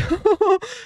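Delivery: sudden high-pitched giggle; high laugh. Real, not acted. Streamer amused that the bot disliked a message, giggling. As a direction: burst out giggling.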